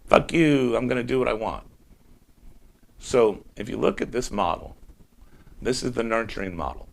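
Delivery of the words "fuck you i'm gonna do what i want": loud mock toddler defiance; rebellious child answering a scolding parent; defiant rebel. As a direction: throw it back at the parent, defiant. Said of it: "the rebel goes".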